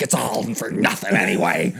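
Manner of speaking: a touch of mock despair; lightly voiced unhinged coach; coach gone mental. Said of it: "He just totally goes mental".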